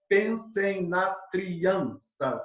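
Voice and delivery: very slow, measured verse scansion; counting syllables. Shown as a demonstration. Teacher demonstrating five-syllable verse, slow syllable counting. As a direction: beat out each syllable slowly. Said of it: "com cinco sílabas métricas".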